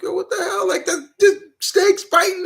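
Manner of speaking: put-on high, startled kid's cry; shocked kid. Kid watching wrestling, shocked at the snake bite, high startled. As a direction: gasp it out like a stunned child. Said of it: "I was like".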